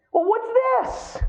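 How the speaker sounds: high, slow mock surprise; drawn-out high curious voice. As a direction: ham up a visitor's double take. Acted visitor spotting the photo.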